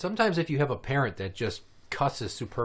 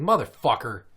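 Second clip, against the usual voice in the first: loud, sharp mock outburst; retold driver's curse; 6 dB louder than usual. Parent cursing at a driver, retold angry outburst. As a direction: curse out another driver, louder on the end.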